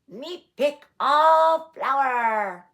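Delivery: grinning sister. A narrator's reading. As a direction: grin through it, slow and loud. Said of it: "the young one grinned".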